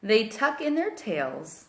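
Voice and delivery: put-on storybook read-aloud for little kids; reading to children. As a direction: read it like bedtime story time. Reads picture book aloud to kids.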